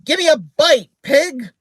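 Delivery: shrill, loud squawking parrot insult; rude parrot. A narrator's reading. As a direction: squawk it like a cheeky bird. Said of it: "rudely said".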